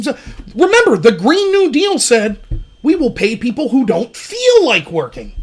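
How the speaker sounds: loud, high-pitched impassioned rant; fired-up rant. Real, not acted. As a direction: build the outrage, pushing each point. Heated rant on communism.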